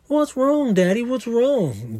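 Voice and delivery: put-on high-pitched little kid voice; imitating young daughter. Daughter asking her crying dad what's up, high imitated child voice. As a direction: pipe up like a concerned child. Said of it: "So Stevie's like".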